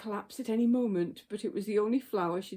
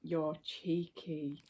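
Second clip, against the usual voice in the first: usual speaking voice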